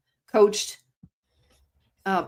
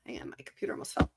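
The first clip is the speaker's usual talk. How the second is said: quick whispered aside; hurried whisper under breath; 11 semitones lower, 12 dB quieter, much faster than usual. Real, not acted. Aside about computer falling.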